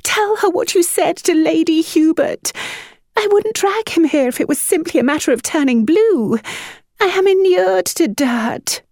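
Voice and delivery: high, trembling dramatic command; throbbing accents. A narrator's reading. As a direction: throb with tragedy.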